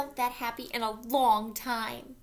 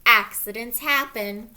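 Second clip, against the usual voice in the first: 1 dramatic loud turn; 2 semitones lower, 11 dB louder than usual. A narrator's reading.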